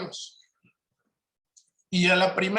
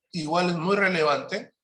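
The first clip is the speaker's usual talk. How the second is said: ordinary talk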